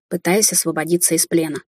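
ordinary delivery, nothing marked

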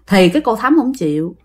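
plain reading voice

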